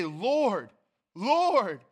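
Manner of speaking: rising urgent cry of Lord, Lord; emphatic plea. Retold or imitated. Pastor voicing believers pleading with Jesus, emphatic. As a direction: call out as if desperate. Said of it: "It's, it's emphatic".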